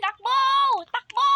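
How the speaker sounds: high frantic yell; panicked shout. Acted. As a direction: scream at him to run. Panicked urging to run.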